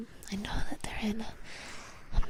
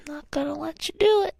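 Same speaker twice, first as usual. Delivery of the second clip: playful defiance; 6 semitones higher, 5 dB louder than usual. Acted.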